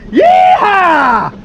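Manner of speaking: high, loud yeehaw with a falling end; loud cowboy holler. Real, not acted. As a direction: holler like a rodeo rider. Celebrating two bucks caught.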